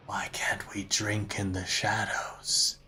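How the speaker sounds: eerie whisper from the shadows. Acted. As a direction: whisper it like an unseen presence. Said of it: "you hear a response".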